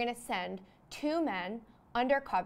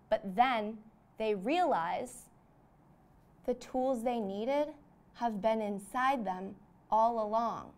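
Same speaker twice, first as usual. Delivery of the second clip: dramatic slow build; slower than usual. Acted. Dramatic movie-trailer revelation.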